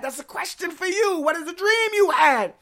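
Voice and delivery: high, forceful pulpit cry; impassioned preaching challenge. Real, not acted. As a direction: throw the question hard at the congregation. Turns text on congregation.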